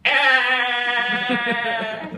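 loud high-pitched scream; child scream. Retold or imitated. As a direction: scream like a hurt kid.

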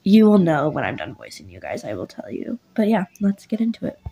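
usual speaking voice

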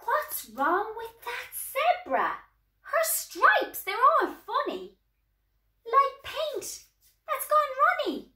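high, loud, puzzled exclamation; puzzled exclaiming. A narrator's reading. Elephant asking about a strange striped animal, puzzled exclaiming. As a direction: exclaim in high-pitched bewilderment.

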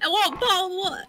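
fast, laughing mock British; British accent. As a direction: toss it off in a hammy British accent. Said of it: "I can make fun of, is that British?".

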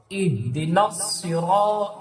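slow, সিরা with রা held one alif; stretched ra. Shown as a demonstration. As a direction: lengthen the রা in সিরা. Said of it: "এখানে এক আলিফ টান দিবেন". (এক আলিফ টান)

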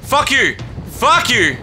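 real panicky outburst; startled refusal. Real, not acted. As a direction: blurt it while backing away fast. Recoiling from monster.

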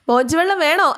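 teasing offer. Acted. Vikram teasing Veda mockingly.

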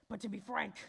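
hushed unvoiced whisper. Narrator whispering to the listener. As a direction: let it slip out as a secret.

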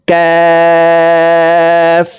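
"কাফ" with the vowel held very long on a high level tone; four-alif stretch. Shown as a demonstration. Teacher demonstrating kaf, long drawn-out hold. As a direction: draw out "কাফ" as one steady chanted note. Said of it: "চার আলিফ টান হবে".

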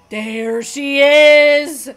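slow, loud, drawn-out reveal; loud announcement. Real, not acted. Player greeting a character's appearance, loud and drawn out. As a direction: stretch each word out, loudly. First of two takes, called out.

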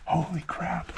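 normal talking voice

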